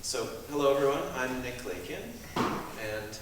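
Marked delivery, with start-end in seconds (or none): none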